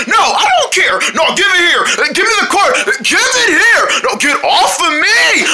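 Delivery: high, insistent grabbing demands; worked-up high protest; squabbling. Acted. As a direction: argue at high pitch mid-tussle. Tussle over the quarter.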